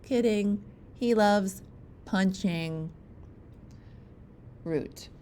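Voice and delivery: plain reading voice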